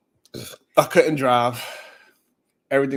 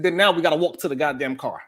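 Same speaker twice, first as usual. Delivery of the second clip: ordinary talk, nothing marked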